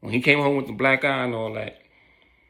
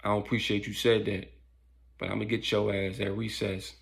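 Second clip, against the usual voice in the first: low, soft threat, voiced rather than whispered; understated menace in a lowered voice; retold whisper; 3 semitones lower, 7 dB quieter than usual. Bully in Brandon's ear threatening recess, hushed. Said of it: "he kinda whispered to him something".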